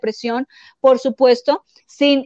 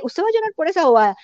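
mock scolding; 5 semitones higher, much faster than usual. Imitating a dismissive scold.

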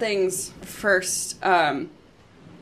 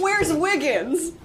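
slow, high, alarmed question; dawning alarm; 9 semitones higher, much slower than usual. Real, not acted. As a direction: realize the friend is missing. Sudden realization, slow high.

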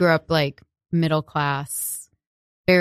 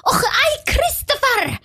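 much higher sweet mock greeting; mom's sing-song greeting; 3 dB louder, slower than usual. Imitating his mom greeting him, high and sweet. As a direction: chirp it like a doting mother. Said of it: "and his mom would go".

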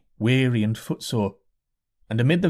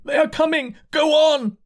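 pushing crowd; 4 dB louder than usual. A narrator's reading. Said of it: "said the people, thrusting at him".

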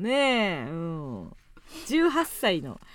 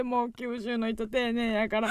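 normal talking voice

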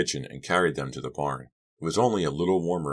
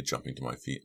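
plain reading voice

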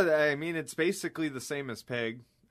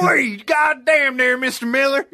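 much higher, much louder hammed-up Southern holler; hollering Southern yokel; 13 semitones higher, 11 dB louder than usual. Cohost joining the mockery with a shouted Southern accent. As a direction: whoop it out like a rowdy Southern hunter.

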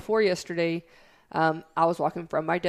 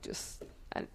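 breathy whisper, barely there; voiceless whisper to a worried coworker; whispered brush-off; 16 dB quieter than usual. Retold or imitated. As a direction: shrink away and whisper it. Quoting herself brushing off coworkers.

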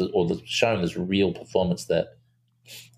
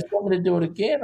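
put-on much higher, fast and eager kid voice; a quick, high boyish offer; imitating the boy; 11 semitones higher, much faster than usual. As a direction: rattle it off like a keen child. Boy eagerly offers another take.

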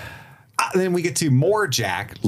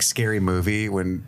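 ordinary talk, nothing marked

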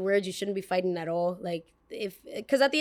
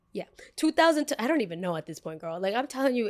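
usual speaking voice

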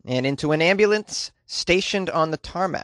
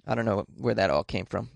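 ordinary talk

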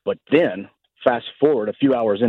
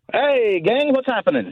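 cheery greeting; 10 semitones higher than usual. Real, not acted. Caller greets the hosts.

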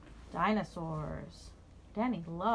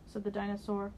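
plain reading voice

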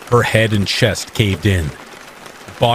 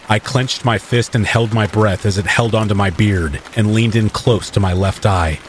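ordinary delivery, nothing marked

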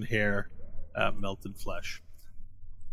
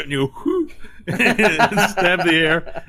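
ordinary delivery

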